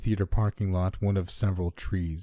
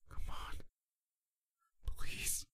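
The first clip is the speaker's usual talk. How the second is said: voiceless, very soft whisper; without breath; 11 dB quieter, much slower than usual. A narrator's reading. Narrator pleading in the airless void, whispering. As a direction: mouth it on almost no air. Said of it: "I said without breath, without words".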